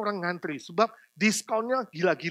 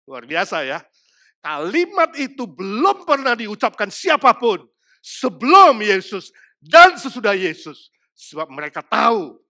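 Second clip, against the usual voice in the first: ordinary talk, nothing marked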